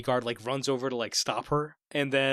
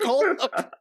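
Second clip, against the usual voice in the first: high mock hold up; 13 semitones higher, 6 dB louder than usual. Own reaction quoted.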